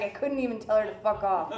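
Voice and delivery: usual speaking voice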